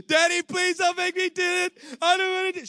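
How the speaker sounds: high, loud mock pleading of a crying girl; imitating a daughter's tearful plea, high and loud. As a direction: beg like a panicking child. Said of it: "She starts crying and having a breakdown".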